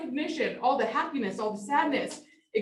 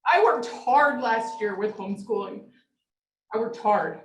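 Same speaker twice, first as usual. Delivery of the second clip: ordinary talk, nothing marked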